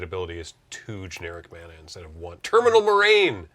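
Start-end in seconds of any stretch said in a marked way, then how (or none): 2.4-3.5 excited. Real, not acted. Finally remembers the card name.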